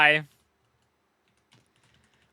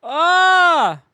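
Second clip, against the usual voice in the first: loud, high, sustained "ah"; frustrated yell; 12 semitones higher, 10 dB louder than usual. Real, not acted. Streamer held up by lag, frustrated yell. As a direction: let out one long yell of frustration.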